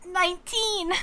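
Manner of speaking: high, loud disbelief at the number; high startled cry of nineteen; shocked exclaim. Real, not acted. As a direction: blurt it out in shock. Shocked at battery level.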